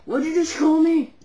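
loud offended demand; angry. Acted. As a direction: confront the insult. Offended prank call victim.

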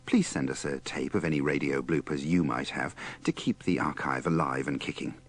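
normal talking voice

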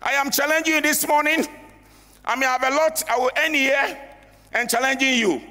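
high, forceful challenge from the pulpit; impassioned sermon challenge; preaching. Acted.

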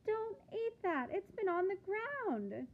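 high, loud frantic cry; cried out. Acted. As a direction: call out in a panicked high voice. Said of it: "she cried".

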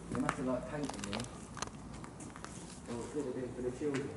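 ordinary delivery

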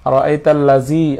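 আরাআইতাল্লাযী ending in a buzzing "zee"; wrong hard z. Shown as a demonstration. Teacher showing what we don't say, buzzing z in আরাআইতাল্লাযী. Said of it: "আরাআইতাল্লাযী আমরা বলবো না".

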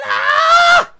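sudden high frustrated shout; frustrated yell. Real, not acted. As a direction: let out a real cry of frustration. Frustrated gamer losing the ball.

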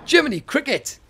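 high, loud startled cry; surprised exclamation. Real, not acted. Player startled by a charging terror bird, loud surprised yelp. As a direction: yelp it out in alarm.

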